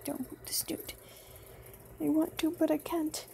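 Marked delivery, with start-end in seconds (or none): 0.0-0.9 hushed whisper to oneself; whispered aside. Real, not acted. Player reminds self about the snoot, whispered aside. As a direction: drop to a whisper.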